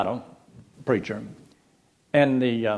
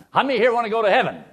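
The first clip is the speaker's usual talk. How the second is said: booming preacher; 11 semitones higher, 4 dB louder, faster than usual. Retold or imitated. Preacher repeats question.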